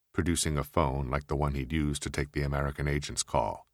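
usual speaking voice

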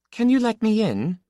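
high-pitched woman's request; woman at the door, pitched well up. A narrator's reading. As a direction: ask in a high character voice. Woman asking him at the door.

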